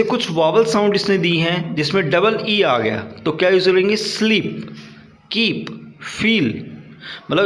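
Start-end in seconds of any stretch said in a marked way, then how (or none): 4.0-6.5 स्लीप, कीप, फील with the ee drawn out slowly; long ee vowel. Shown as a demonstration. Teacher showing students the long ee, slow and drawn out. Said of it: "जो sound है वो थोड़ा सा lengthy जा रहा है".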